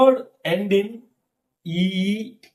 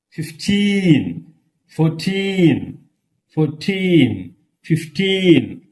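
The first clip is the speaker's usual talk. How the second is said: put-on "Fifteen", "fourteen" with a long falling TEEN; stress on second syllable; much slower than usual. Shown as a demonstration. Teacher drills numbers ending in teen, last syllable drawn out. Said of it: "and teen also".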